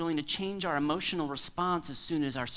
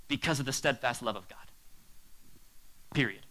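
ordinary delivery

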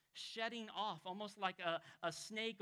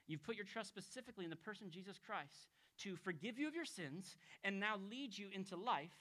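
usual speaking voice

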